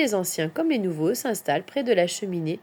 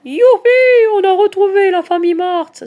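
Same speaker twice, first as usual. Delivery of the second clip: high, loud whoop of joy; rejoicing; 11 semitones higher, 12 dB louder than usual. A narrator's reading. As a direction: cheer as if a search just succeeded. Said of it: "se réjouit Charlotte". (cri de joie aigu et fort)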